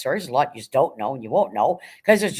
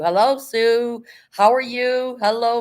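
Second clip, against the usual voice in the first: sing-song hello, higher and louder; slow, raised welcome to a viewer; bright greeting; 7 semitones higher, 5 dB louder, slower than usual. Real, not acted.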